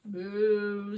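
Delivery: loud, held moo; cow moo. Acted. Cow answers the mask riddle, loud moo. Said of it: "says the cow".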